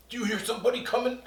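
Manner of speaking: high, fast puppet whisper-question; banana puppet hears someone coming. Acted. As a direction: rush it like an excited puppet. Puppet asks in a hurry.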